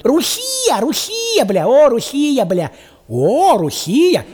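high, loud mocking wail of Русия; mock locals' exclamation. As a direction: ham up the foreigners crying Русия. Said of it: "они там нихуя не понимают".

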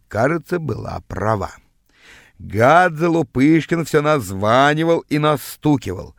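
ordinary delivery, nothing marked

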